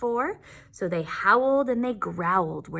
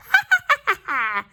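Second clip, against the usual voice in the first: high, louder cackling laugh; witch cackle; 6 dB louder than usual. Shown as a demonstration. Teacher showing the class a witch's laugh, cackling. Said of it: "A cackle's like a witch's laugh".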